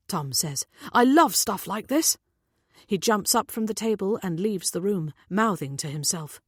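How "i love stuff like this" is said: put-on higher, louder teenage eagerness; enthusiastic. A narrator's reading. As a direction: say it with keen enthusiasm. Tom delighted by the puzzle.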